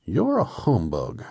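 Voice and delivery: slow, loud, aggrieved accusation; grieved tone. A narrator's reading. Scarecrow accusing the little man, slow grieved tone. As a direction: draw it out loudly, hurt. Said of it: "in a grieved tone".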